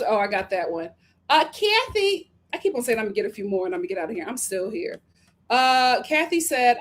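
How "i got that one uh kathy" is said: ordinary talk, nothing marked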